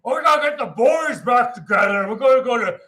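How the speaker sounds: loud, hyped-up movie dude; rowdy rallying. Retold or imitated. Movie character gathering the boys, rowdily excited. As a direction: whoop it up to the gang.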